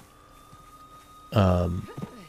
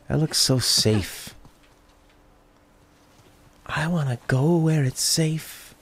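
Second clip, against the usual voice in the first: mock scared whine; 6 semitones higher, slower than usual. Sarcastic whiny voice at danger.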